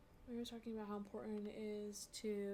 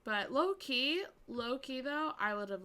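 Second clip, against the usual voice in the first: loud, drawn-out and insistent; emphatic loud aside; 3 semitones higher, 10 dB louder, slower than usual. Real, not acted. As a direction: stress it like a confession you can't hold in. Emphatic confession about the houseboat.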